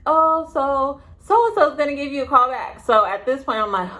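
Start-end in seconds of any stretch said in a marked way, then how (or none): 0.1-2.8 high, breezy mock office voice; lightly voiced receptionist brushing her off; imitating receptionist. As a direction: pitch way up like a chirpy receptionist. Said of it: "So she's like".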